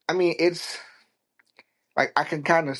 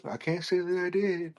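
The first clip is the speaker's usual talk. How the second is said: higher put-on voice, dodging the question; evasive Ed; 6 semitones higher, 3 dB quieter than usual. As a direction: play the killer coyly sidestepping. Voicing Ed dodging murder question.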